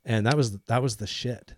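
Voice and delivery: ordinary talk, nothing marked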